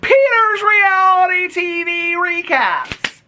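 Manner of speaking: high, slow, held-out channel announcement; showy intro. Acted. Host announcing the channel name to viewers, showy high intro. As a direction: stretch the show title out up high.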